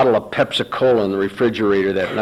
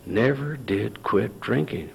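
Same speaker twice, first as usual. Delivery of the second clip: slow, much softer, turning it over; quiet musing; 9 dB quieter, slower than usual. Retold or imitated. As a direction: say it to yourself, pondering. Mulling over Bill's words.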